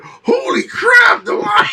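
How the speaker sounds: very high, slow, crying-out shriek; watching the lion vanish, high slow shriek; shocked scream. Retold or imitated. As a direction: wail it up high in horror.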